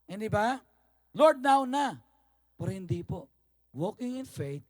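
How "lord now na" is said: loud, high demand for an answer now; insistent, raised-voice plea; impatient prayer. Acted. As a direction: pray it like an order. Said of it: "gusto natin".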